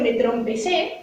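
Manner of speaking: "trompecé" with an added m before the p; extra m sound. Shown as a demonstration. Teacher demonstrating a Chilean added sound. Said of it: "hay muchos que dicen".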